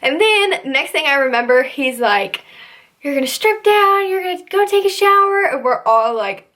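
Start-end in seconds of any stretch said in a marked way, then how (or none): 3.0-5.4 higher, commanding mock order; drill instructor order. Instructor orders the new recruits, mock command. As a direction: bark it like a drill sergeant.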